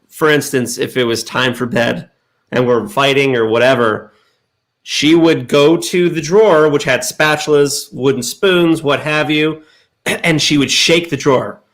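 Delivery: ordinary talk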